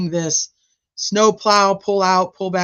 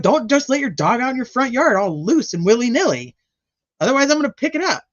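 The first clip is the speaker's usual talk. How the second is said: high scolding rant, speeding up at the end; high mock lecture to dog owners, faster as it goes; 8 semitones higher than usual. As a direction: wag a finger at careless owners. Voicing herself scolding dog owners.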